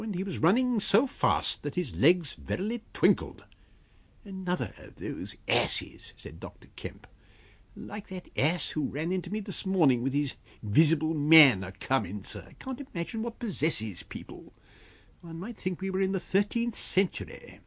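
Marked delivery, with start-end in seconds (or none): 4.2-6.1 slow, softer, higher muttering voice; scornful doctor. Acted. Doctor watching a running man, slow scorn. 7.8-17.6 higher-pitched doctor's voice; scornful doctor, exasperated. Acted. Doctor scoffing alone at superstitious people, high-pitched exasperation.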